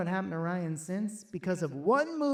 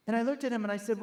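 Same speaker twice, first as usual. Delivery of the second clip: ordinary talk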